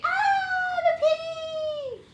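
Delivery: loud, very high mock squeal; scared piggy scream. Shown as a demonstration. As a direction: shriek like a frightened little pig, drawn out. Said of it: "he screams. He's so scared".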